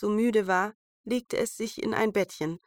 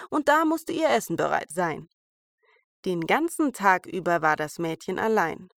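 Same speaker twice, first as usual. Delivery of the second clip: usual speaking voice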